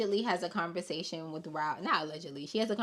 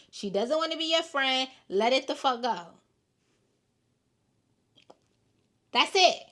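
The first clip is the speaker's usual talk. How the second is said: higher and louder, fed up; exasperated shouting; 7 semitones higher, 8 dB louder than usual. Real, not acted. Host fed up, shouting advice at Shamea through the screen. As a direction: yell it at her to let it go.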